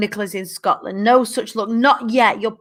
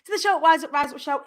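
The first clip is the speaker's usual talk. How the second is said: bright show opening; 7 semitones higher than usual. Real, not acted. Live host greets her viewers, high and excited. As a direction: throw a big high hello to the whole audience.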